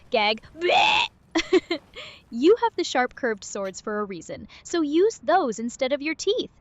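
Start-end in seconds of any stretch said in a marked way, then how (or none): 0.1-1.1 exaggerated loud gagging noise; powerful bleh. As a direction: blurt out a mock retch of disgust. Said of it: "That was a really powerful, bleh".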